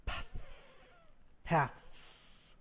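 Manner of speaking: "p" breathed, "Paths" whispered with a hissed s; unvoiced "Paths"; voiceless th, paths. Shown as a demonstration. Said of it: "T H sound".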